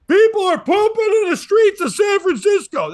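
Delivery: high, loud screamed line; screaming opener. Retold or imitated. Comic Dan opening his set to the crowd, screaming. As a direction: scream it like a wild opening bit. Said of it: "he's just screaming about that".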